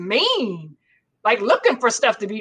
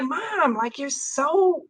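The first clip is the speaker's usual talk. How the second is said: put-on slow, drawn-out child's complaint; imitated complaining kids; 4 semitones higher, much slower than usual. Mother quoting her kids about her anger, drawn-out complaint. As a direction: stretch out the mild mock reproach. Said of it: "my kids would be like".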